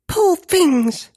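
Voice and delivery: high, small giant voice; high-pitched gentle child giant; quiet-voiced giant. A narrator's reading. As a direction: pity them in a little voice. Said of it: "for a giant, a quiet voice".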